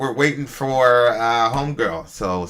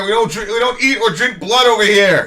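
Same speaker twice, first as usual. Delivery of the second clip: mock disgust; 8 semitones higher, 5 dB louder, much slower than usual. Mock disgust at blood pudding.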